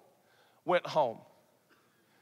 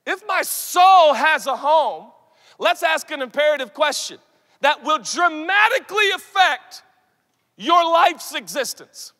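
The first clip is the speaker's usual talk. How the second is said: loud, raised, insistent preaching; fervent preaching; 6 semitones higher, 6 dB louder than usual. Real, not acted. Preacher pressing the youth crowd, loud and fervent. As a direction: drive the question home.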